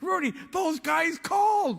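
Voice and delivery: high, loud breathless-news delivery; imitating an excited caller; excited phone call. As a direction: burst out with the big news.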